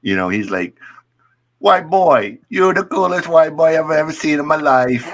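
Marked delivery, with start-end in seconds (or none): 1.6-5.0 put-on Jamaican accent. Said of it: "six-foot-four Jamaican".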